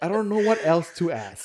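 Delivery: loud, high protest through laughter; half-laughing yell at the pastor; exasperated mock yell. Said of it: "you're, like, yelling at the pastor".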